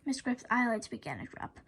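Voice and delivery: ordinary delivery, nothing marked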